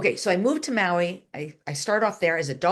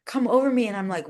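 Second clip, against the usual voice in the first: normal talking voice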